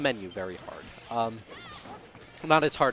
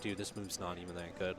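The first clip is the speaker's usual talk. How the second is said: mocking quote; 5 semitones lower, 12 dB quieter than usual. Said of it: "people talked about that move".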